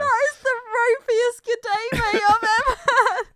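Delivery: can barely get the words out for laughing; helpless giggly laughing at a friend's bad Aussie accent. Real, not acted. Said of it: "I'm crying".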